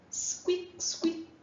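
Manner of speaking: very high, whispered squeak like a tiny mouse; mouse squeak imitation. Shown as a demonstration. Teacher imitating a mouse for the class, tiny high squeak. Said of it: "Who makes that noise?".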